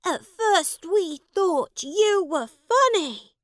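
high, loud jeering shout; the boys yell at him together. Acted. Schoolboys shout at the ragged prince, loud and high. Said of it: "they shouted".

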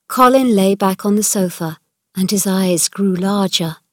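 usual speaking voice

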